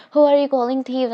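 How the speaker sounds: loud, high offended snap; indignant retort. Retold or imitated. Sister grabbing the phone, loud offended retort. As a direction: throw the accusation back hard.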